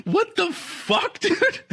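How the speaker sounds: very high, loud, drawn-out squeal; incredulous high-pitched reaction on the edge of laughter; mock disbelief. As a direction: squeal it in amused, exaggerated shock. Acting out stunned disbelief, laughing.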